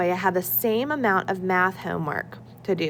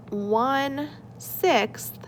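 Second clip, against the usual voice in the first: slow emphasis; 5 semitones higher, much slower than usual. Shown as a demonstration. Teacher slows on key fraction.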